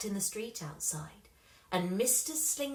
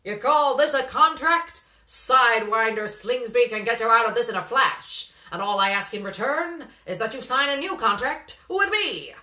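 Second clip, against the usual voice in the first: booming slick agent, much louder; loud brash manager; 2 semitones higher, 10 dB louder than usual. A narrator's reading. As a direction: sell the dream at full volume. Slingsby's loud sales pitch.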